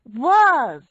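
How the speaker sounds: loud, high-pitched exclamation; shocked outburst. A narrator's reading. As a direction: blurt it out loudly in disbelief. Frances interjects from the kitchen.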